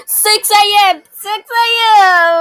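loud, high triumphant cheer; excited shout. Real, not acted. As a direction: cheer with relief. Surviving the night.

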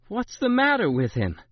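put-on baffled by the boy's fit; bewildered. A narrator's reading. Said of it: "Granny, bewildered".

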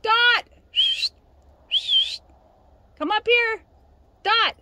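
very high, loud holler across the hillside; hollering for the dog. Real, not acted. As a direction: yell up the mountain so the dog hears. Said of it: "I gotta holler for a minute".

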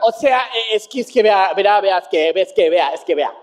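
tripping over repeated fillers, trailing down; mock stammering. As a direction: imitate someone floundering for an excuse. Mimics officials unable to answer.

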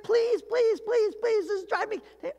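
very high, hammed-up pleading; desperate begging. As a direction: beg over and over in a squeezed high voice. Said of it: "You're like".

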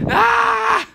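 loud high shout of joy; high triumphant yell. Real, not acted. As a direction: let out a high victory cry. Celebrating finished reps.

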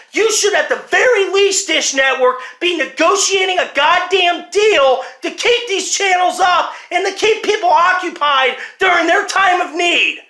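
shouting in real anger, voice high and loud; furious rant. Real, not acted. Angry customer shouting at Dish Network, furious. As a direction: let the fury boil over, yelling. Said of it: "I'm sorry for being this upset right now".

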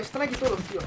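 normal talking voice

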